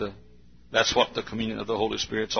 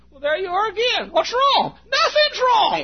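put-on high, louder whiny girl's voice; piping complaint, much higher and louder; sulky girlfriend; 7 dB louder than usual. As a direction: whine it up high like a pouting girlfriend. Couple bickering, girl voiced high.

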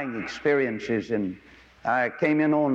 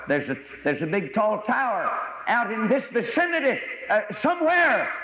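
higher, rising alarm of a pilot near panic; nervous radio call climbing up; 9 semitones higher than usual. Retold or imitated. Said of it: "became alarmed and almost panicked".

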